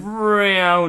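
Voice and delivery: spelt-out swooping engine noise, high-pitched; aeroplane noise. A narrator's reading. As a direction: imitate a plane zooming past. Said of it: "running around making".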